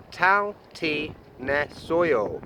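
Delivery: "Tal ti ne soyo" spelled out very slowly with a T start; slow Korean phrase. Shown as a demonstration. Vlogger slowly demonstrating how are you, syllable by syllable. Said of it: "J do not sound like a J".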